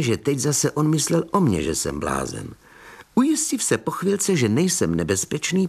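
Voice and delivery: ordinary delivery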